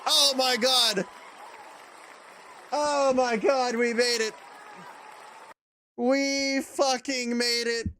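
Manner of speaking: high, slow elated cry; relieved laughter. Real, not acted. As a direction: savor the relief slowly. Elated after hold ends.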